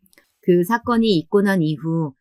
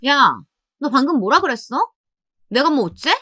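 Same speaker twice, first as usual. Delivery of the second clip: indignant, high-pitched challenge; flaring up; 6 semitones higher than usual. A narrator's reading. Sister rounds on her muttering brother, flaring up. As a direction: round on him, voice jumping up. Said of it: "신우이가 발끈하고 덤볐어요".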